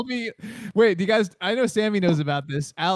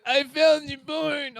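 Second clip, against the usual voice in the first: high, very slow, tearful confession; mock crying; 8 semitones higher, much slower than usual. Crying friend confessing he found the porn, mock tearful. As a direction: drag it out like a guilty sob. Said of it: "but he was, like, crying".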